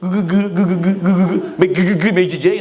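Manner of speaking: rhythmic stammering with a verbal tic; imitated stutter. Teacher mimicking the orator's tic, stuttering like a DJ. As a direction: get stuck on "gu" like a skipping record. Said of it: "E tinha um cacuete".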